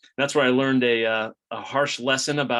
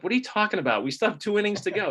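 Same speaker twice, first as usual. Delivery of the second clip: high-pitched, disbelieving protest; mock disbelief at a premature celebration; incredulous retort; 9 semitones higher, faster than usual. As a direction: retell your incredulous reply, pitched well up. Incredulous retort to dad's early congratulations.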